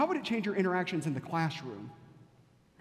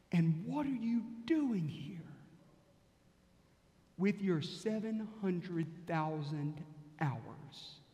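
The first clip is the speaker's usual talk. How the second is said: soft, slow and partly whispered; hushed searching question; 5 dB quieter, much slower than usual. Acted. Preacher quietly asking the congregation who they are, hushed. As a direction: ask it gently so it lingers.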